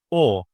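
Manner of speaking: O from far back, almost in the throat; back rounded vowel. Shown as a demonstration. Teacher demonstrating where O comes from, throaty back vowel. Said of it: "It comes from my throat almost".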